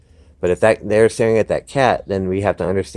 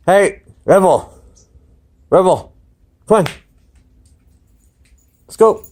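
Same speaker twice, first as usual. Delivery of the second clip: loud, high call across the yard; calling the dog; 10 semitones higher, 6 dB louder than usual. Real, not acted. Trainer calling his nervous dog to come. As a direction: call her over sharply and bright. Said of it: "calling the dog to him".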